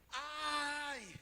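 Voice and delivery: sharp mock cry of pain; high startled "Ai!" cry; retold scream. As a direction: yelp it like the healer startled. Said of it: "ela deu um grito".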